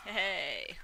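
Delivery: loud playful shout. Real, not acted. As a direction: call out to taunt. Taunting zombies.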